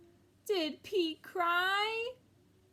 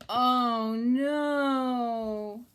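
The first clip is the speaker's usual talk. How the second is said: louder dismayed cry; loud alarmed oh no; 4 semitones lower, 8 dB louder than usual. Acted. Reading to kids as the last button goes, loud dismay. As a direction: wail it with mock dismay.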